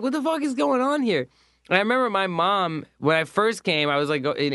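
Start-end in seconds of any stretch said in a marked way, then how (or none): 0.0-1.3 shocked. Retold or imitated. Shocked by gross lunch.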